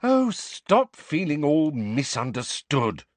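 slow, high, sharp reproof. A narrator's reading. Hermione scolding self-pitying Harry, sharply. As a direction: tell him off sharply, dragging the words. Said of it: "said Hermione sharply".

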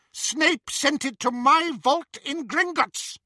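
high, slow incredulous witch; Bellatrix's shrill disbelief. Acted. Bellatrix to Greyback, high slow incredulous witch.

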